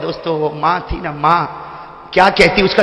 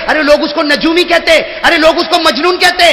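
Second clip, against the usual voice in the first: urgent warning; 9 semitones higher, 4 dB louder than usual. Acted. Old woman's urgent warning against a man.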